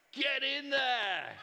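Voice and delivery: high, slow, drawn-out delight; thrilled cheer. Acted. Wife celebrating the gold, high and drawn out. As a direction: savour the win, high and stretched.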